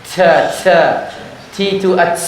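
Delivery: "Ca, ca" hissed like sin; ta turned into sin. Shown as a demonstration. Teacher showing students ta mixed with sin. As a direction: mispronounce ta with a sin hiss. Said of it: "Apalagi diganti dengan sin".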